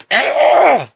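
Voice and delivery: loud high-pitched yell; piercing cry out; high scream. Acted. Sudden scream after a curse.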